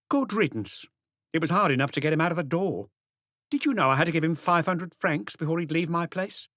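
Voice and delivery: a hint of a sharp, defiant niece; hard woman. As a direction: tough niece snapping at the inspector. Angèle complains about Marcel.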